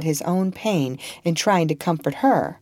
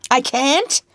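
higher, louder mocking roar; roaring with rage; 10 semitones higher, 4 dB louder than usual. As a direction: hurl the question back in a rage. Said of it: "roared Legree".

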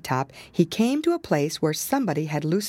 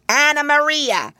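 much higher, louder rat call; an old rat summoning his wife; 10 semitones higher, 7 dB louder than usual. Acted. Samuel Whiskers calls his wife.

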